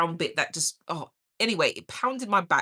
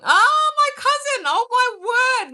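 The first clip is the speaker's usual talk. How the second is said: much higher and louder, thrilled cry; delighted squeal; 7 dB louder than usual. Real, not acted. Greeting her cousin joining the live, excited squeal. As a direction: squeal with real joy at the surprise.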